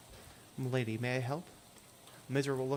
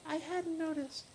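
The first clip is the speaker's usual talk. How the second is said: put-on much higher, thin ghostly girl voice; hitchhiker Lydia; 14 semitones higher than usual. Hitchhiker asking the player for a ride, high ghostly voice. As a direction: play the strange girl in the rain begging a lift.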